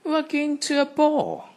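very high, loud gloating squeal; stroppy stepsister cry; stroppy sister crying. Acted. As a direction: crow it over Cinderella. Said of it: "cried the stroppy one".

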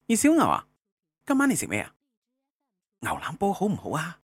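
high cartoon character voice. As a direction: play a cheeky cartoon rabbit, voice up high. Skit rabbit character greets friend.

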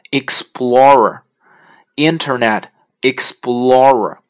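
slow, drawn-out Explorer said clearly; careful English name. Shown as a demonstration. Teacher modelling the browser name slowly. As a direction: spell out Explorer slowly for learners. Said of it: "那它全称就是Internet".

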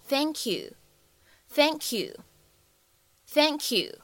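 "thank you" slowed right down, the k running into a "kyu" sound; linked thank you. Shown as a demonstration. Teacher demonstrating to students, slow and linked. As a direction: drag out "thank you" so it ends like the letter Q. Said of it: "会有一个类似于连读的处理".

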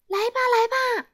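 rapid excited call; urgent calling. Acted. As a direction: hurry him out of bed. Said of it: "他喊道".

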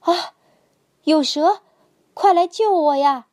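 frightened big brother yelling for rescue; panicked shout. A narrator's reading. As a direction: scream for help, terrified. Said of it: "哥哥急得大叫".

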